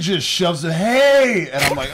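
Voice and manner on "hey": high, mock-booming call; intrusive greeting. Retelling fan shoving into their conversation, mock intrusive shout. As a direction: butt in with a high hail.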